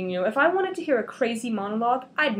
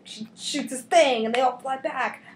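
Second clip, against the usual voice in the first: ordinary talk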